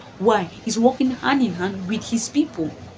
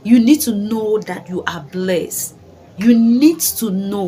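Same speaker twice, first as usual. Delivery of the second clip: "You need to know" said slowly and louder, leaning on "need"; slow emphatic stress; 5 dB louder, slower than usual. Real, not acted. Telling Burkina Faso's people they are blessed, slow heavy emphasis. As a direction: drag out "need to know", pressing each word. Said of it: "like I emphasize on that word".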